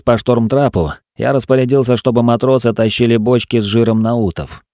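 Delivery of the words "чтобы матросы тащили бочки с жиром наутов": usual speaking voice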